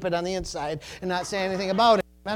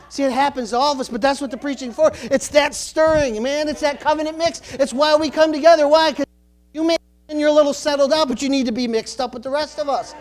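higher, louder, insistent preaching; fired-up preaching; 7 semitones higher, 7 dB louder than usual. Real, not acted. Preacher urging congregation to get mixed up, fired-up. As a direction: drive the point home with fervour.

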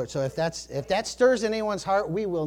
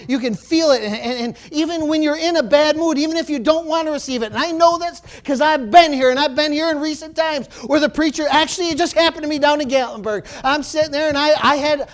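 higher, louder preaching voice; fired-up preaching; 8 semitones higher, 8 dB louder than usual. Real, not acted. Preacher stirring up the congregation, fired-up. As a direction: preach it with rising fire.

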